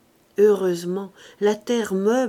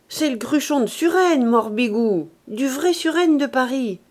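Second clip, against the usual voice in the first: loud, jovial and high; hearty forced mirth; 4 semitones higher, 6 dB louder than usual. A narrator's reading. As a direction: burst out in boisterous good cheer. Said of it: "Fauchelevent éclata de rire". (gaieté bruyante et joviale)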